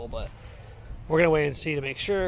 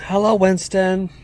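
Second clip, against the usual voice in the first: slow, loud, high greeting; child's greeting; 4 semitones higher, 10 dB louder, slower than usual. Retold or imitated. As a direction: call out a slow hello. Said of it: "He said".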